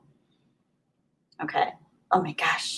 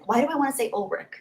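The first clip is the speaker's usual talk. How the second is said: ordinary delivery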